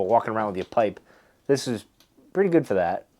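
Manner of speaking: usual speaking voice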